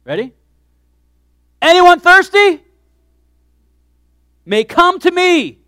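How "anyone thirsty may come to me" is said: loud, high and slow, calling out to a crowd; shouted call. Acted. Preacher voicing Jesus calling to the crowd, loud shout. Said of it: "He actually shouted it".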